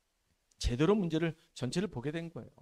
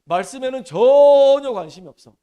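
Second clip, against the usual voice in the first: much louder, higher, 전혀 hammered hard; preaching shout; 6 semitones higher, 16 dB louder than usual. Acted. Preacher rebuking the disciples' indifference, loud preaching shout. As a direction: shout it out, stress 전혀 with full force. Disciples care nothing for the word.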